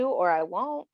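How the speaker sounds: normal talking voice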